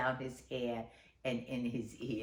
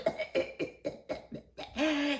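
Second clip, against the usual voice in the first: spluttering puppy cough; 12 semitones higher than usual. Acted. Drowning puppy dog.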